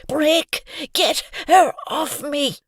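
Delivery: high, loud desperate plea; panicked call. Acted. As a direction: yell for help, pinned down. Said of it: "called out to his fellow bully".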